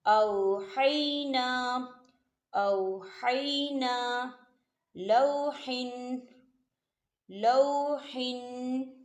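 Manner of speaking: औहैना and लौहिन drawn out slowly with a thin, light हा; slow recitation, light Ha. Shown as a demonstration. Teacher showing thin Ha, slow and precise. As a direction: recite slowly, keep the हा light, not heavy. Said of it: "कैसे? हा बारीक पढ़ा जाएगा".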